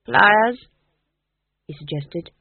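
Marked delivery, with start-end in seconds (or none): 0.0-0.7 higher, louder hopeful guess; anxious ghost king. Acted. Ghost king guessing at Nanny's I spy, eager and louder. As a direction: blurt the guess out, eager to please.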